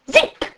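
high-pitched quick arrow zip; short, high sound effect of an arrow. A narrator's reading. Narrator imitates flying arrow.

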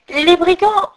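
ordinary delivery

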